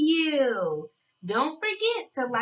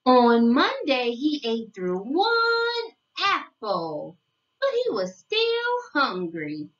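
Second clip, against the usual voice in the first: storybook reading; 3 semitones lower than usual. Acted. Narrator reading a picture book to young children, sing-song storytelling. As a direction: read it like a bedtime picture book, savoring each day.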